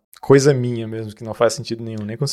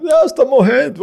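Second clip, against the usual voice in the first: high, loud-ish mock operator; atendente imitado; 13 semitones higher, 4 dB louder than usual. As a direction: mimic a garbled emergency operator, pitched way up. Imita atendente de emergência incompreensível. (imitação aguda de atendente português incompreensível)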